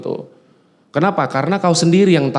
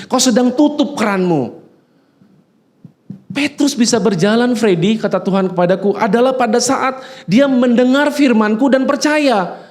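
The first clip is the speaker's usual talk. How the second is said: high, urgent voice of God rebuking; preaching at full force; 8 semitones higher than usual. Acted. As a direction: press every line hard, pitched high. (khotbah berapi-api dengan suara tinggi)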